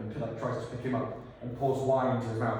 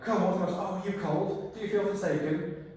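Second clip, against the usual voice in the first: higher, mock-sweet taunting; bitchy taunt; 8 semitones higher than usual. Creature mocking freezing Victor in a catty sing-song. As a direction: coo it with fake pity. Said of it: "You are a bit bitchy about it".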